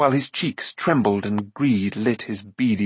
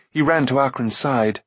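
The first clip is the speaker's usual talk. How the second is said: plain reading voice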